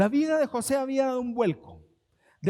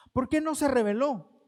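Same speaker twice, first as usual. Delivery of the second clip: ordinary talk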